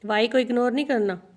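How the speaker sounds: usual speaking voice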